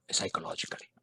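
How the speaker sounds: "psychologically" whispered. Real, not acted. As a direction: drop to a whisper. Telling of sister's trauma.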